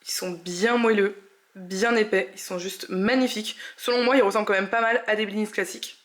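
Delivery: talking while chewing; words round a mouthful; mouth full. Real, not acted. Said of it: "Je parle la bouche pleine".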